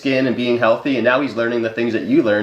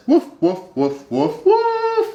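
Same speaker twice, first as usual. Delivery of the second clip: bouncy mock barking for the baby; playful dog barks; 9 semitones higher, slower than usual. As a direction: woof it out like a cheerful puppy. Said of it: "That's what my dog always says".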